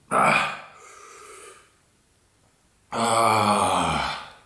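usual speaking voice

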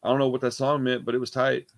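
normal talking voice

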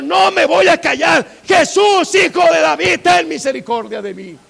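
defiant high shout turning to a plea; full-voiced desperate clamor; crying out. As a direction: refuse loudly, then call out for mercy. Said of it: "clamó".

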